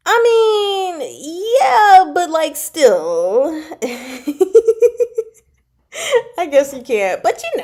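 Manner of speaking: talking through a real high laugh; giggly protest, slowing at the end. Real, not acted. Laughing at chat's comment.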